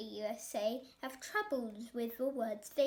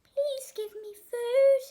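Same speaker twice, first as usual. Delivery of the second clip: high, loud, slow wail; a starving animal begging; pleading cry; desperate cry; 10 semitones higher, 11 dB louder than usual. A narrator's reading. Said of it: "the creature cried".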